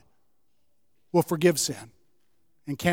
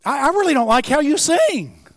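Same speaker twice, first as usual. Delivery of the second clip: higher, whiny fickle voice; preacher mocking an imagined sulky God, higher; mock petulant God; 8 semitones higher, 5 dB louder than usual. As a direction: pout like a fickle deity.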